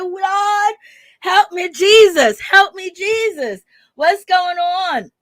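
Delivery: playful mock prayer of disbelief; exasperated plea to Jesus. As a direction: beg heaven for patience, mock despair. Exasperated reaction to interview claims.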